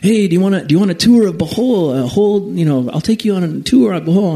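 a hint of a keen motorcycle guy pitching a tour; eager local guide. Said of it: "this guy's like".

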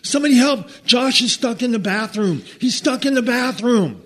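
put-on high, loud frantic kid shouting for help; panicked kid yelling. A boy shouting to the storyteller for help, high and loud. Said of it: "this voice going".

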